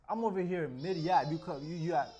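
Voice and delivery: loud mock-outraged complaint; exasperated at being cut off; annoyed. Real, not acted. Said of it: "I can't even talk".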